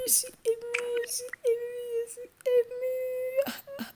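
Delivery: very high, soft, mock crying voice; mock tearful. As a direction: whimper it out like fake tears. (fausse voix émue qui pleure)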